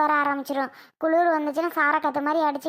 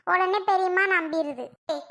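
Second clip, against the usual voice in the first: usual speaking voice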